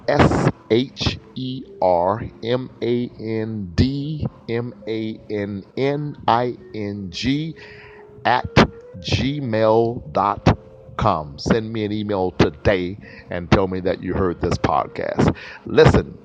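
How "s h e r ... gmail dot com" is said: spelled out slowly, letter by letter; each letter set apart, slow and clear; slow spelling. Shown as a demonstration. Host spelling his email for listeners, slow and deliberate. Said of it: "for the last time".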